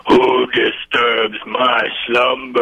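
slow grinding statue voice; grinding stone statue. Pretend. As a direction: rumble it out like a waking stone guardian. Caller answers in mock monster voice.

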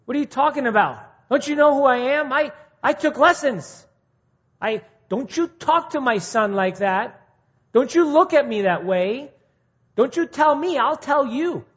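high, loud, offended singer; indignant outrage. Retold or imitated. Preacher acting a rebuked singer, loud indignant outrage. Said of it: "and we're like".

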